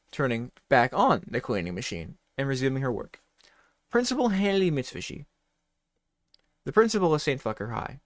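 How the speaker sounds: ordinary talk, nothing marked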